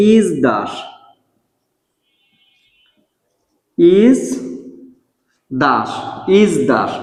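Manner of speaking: ইসদাস with a buzzing z, like ইজদাস; ইসদাস drawn out slowly, ইজ-দাস; slow model pronunciation. Shown as a demonstration. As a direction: stretch out ইসদাস, z sound before d. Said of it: "এই শব্দটার উচ্চারণ হচ্ছে ইসদাস".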